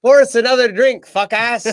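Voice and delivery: high, loud mock yell of a Tourette's character; tic yell. Imitating the movie character's tic, high loud yell.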